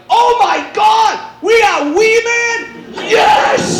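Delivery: high, slow, thrilled yelling fans; thrilled shout. Retold or imitated. Golfers thrilled to get Wee Man, high excited shout. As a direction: shriek it like starstruck fans. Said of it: "and they're like".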